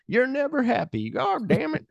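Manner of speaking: hammed-up high grumble; mock Stumpy grumble. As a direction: squeak it out like a cranky old sidekick. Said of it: "And John Wayne being like".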